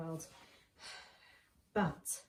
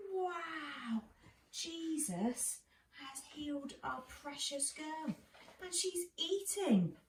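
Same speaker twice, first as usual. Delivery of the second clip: amazed parents; 7 semitones higher, slower than usual. Acted. Parents marvel at healed daughter.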